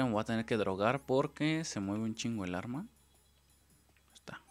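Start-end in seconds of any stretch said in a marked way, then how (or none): 4.1-4.4 whispering. Real, not acted. Whispering while sneaking in game.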